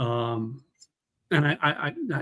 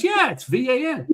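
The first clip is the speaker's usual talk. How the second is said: high, different lady voice spelling it out; chirpy lady voice spelling; Texas lady; 10 semitones higher than usual. Retold or imitated. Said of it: "She goes".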